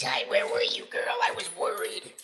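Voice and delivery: high, whispered dog voice; Jack the dog talking. Pretend. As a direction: give the dog a tiny high whispery voice, all breath. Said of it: "Jack says".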